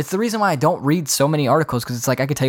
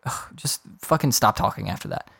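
ordinary talk, nothing marked

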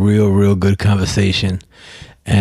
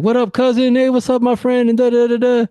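much higher, cheery cashier greeting; friendly cashier. Retold or imitated. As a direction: imitate the cashier's happy hello. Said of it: "they always respond back".

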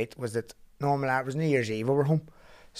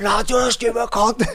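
high, loud mock insult; retold scolding; 11 semitones higher, 9 dB louder than usual. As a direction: scold your mate. Friend scolding him.

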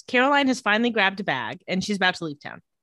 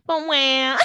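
mock whining; 5 semitones higher, 4 dB louder than usual. Mocking the character's complaint.

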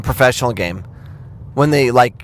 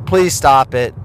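ordinary delivery

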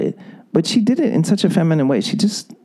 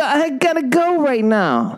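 slow, pitched-up mock bail-out; lightly hammed-up boyfriend escaping the talk; fleeing husband; 9 semitones higher, slower than usual. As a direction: drag it out like a man desperate to leave. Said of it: "he's gonna go".

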